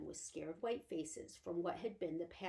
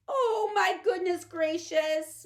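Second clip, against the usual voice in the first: high, loud, slow tearful moan; Homily moaning in despair on the chest of drawers; 11 semitones higher, 13 dB louder, slower than usual. Acted. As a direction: wail it out in dismay. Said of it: "moaned Homily".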